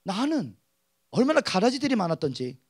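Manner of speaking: ordinary talk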